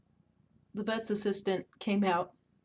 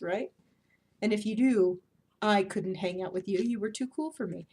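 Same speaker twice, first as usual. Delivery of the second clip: ordinary talk, nothing marked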